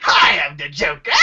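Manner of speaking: cackling villain voice breaking into a high laugh; Joker. Acted. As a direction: announce yourself, then burst into a loud maniacal laugh. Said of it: "do your Joker impersonation for the channel again".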